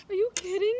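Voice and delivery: high, loud imitated incredulous squeal; excited disbelief. Woman imitating her sister hearing engagement news, excitedly incredulous. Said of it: "she was so excited".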